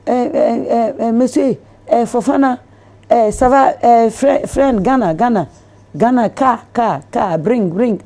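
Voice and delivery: high, loud, halting caller stumbling over French words; imitating the man's broken call. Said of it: "So he was like".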